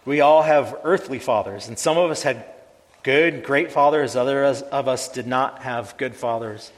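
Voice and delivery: steady, earnest prayer voice, unhurried; pastoral prayer. Acted. Pastor praying for the congregation, reverent and steady. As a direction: pray it over the congregation with reverence.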